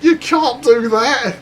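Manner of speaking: high, loud, slow outraged cry; shocked yell. Retold or imitated. Narrator yelling at his friend over the firework, high shocked shout. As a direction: shout it in disbelief at your mate. Narrator aghast at garden explosion.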